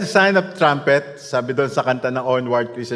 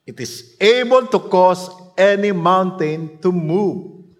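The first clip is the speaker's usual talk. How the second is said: slow, raised, declaiming preacher voice; preaching; 6 semitones higher, 6 dB louder, slower than usual. Real, not acted. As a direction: proclaim it slowly over the crowd. Preaching proclamation. (mabagal at malakas na pangangaral)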